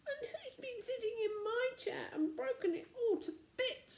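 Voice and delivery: very high squeak with a tearful laugh; squeaky tearful baby bear. A narrator's reading. Baby bear finds his broken chair, squeaking tearfully. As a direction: squeak it out like a little bear near tears. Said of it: "squeaked Baby Bear in tears".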